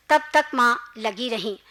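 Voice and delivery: ordinary delivery, nothing marked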